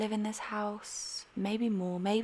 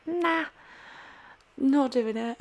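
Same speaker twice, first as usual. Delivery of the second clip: dismissive; 6 semitones higher, slower than usual. Retold or imitated. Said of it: "so I was like".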